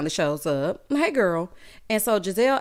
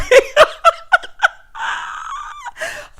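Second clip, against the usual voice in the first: high screaming cackle; real howl of laughter; loud shrieking laugh; 8 dB louder than usual. Real, not acted. Cracks up at own joke.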